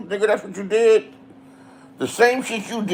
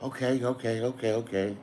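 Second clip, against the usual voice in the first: much softer, lower mock-submissive okays; meek giving in; 7 semitones lower, 14 dB quieter than usual. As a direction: back down quietly like a scolded girlfriend. Woman meekly giving in.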